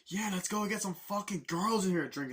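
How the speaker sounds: high, rowdy party call; mock rowdy clubgoer; party hype. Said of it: "It's like".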